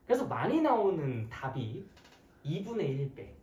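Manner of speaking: usual speaking voice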